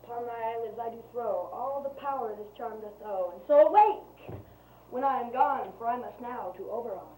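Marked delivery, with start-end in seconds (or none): 3.3-4.4 sudden drawn-out, raised cry; loud spell command. Acted. Servant casts the charm on the sleeper's eyes, loud spell command. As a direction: boom out the magic command, stretched.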